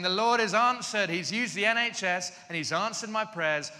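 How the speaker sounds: loud, high, jubilant announcement; triumphant proclamation. Retold or imitated. Running to a friend waving the letter, triumphant.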